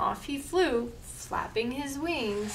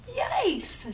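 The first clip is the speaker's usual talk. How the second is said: shouted yelp; 10 semitones higher than usual. Acted. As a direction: yelp it in fright. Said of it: "shouted Ghoul".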